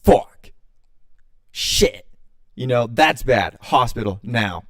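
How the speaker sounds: loud, punched-out single words; forceful, slowing emergency verdict; retold alarm. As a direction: drop each curse like a blow, then order urgently. Retold alarm at seeing the wound.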